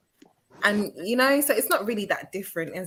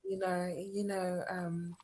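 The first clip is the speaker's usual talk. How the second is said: lower, much quieter "you know"; Eunice voice; 6 semitones lower, 14 dB quieter, slower than usual. Pretend. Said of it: "can you say, "You know," like Eunice, please?".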